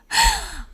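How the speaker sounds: short high-pitched laugh; quick, high burst of laughter. Real, not acted. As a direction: laugh at your own uncertainty. Laughs at own uncertainty.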